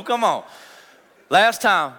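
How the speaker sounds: ordinary delivery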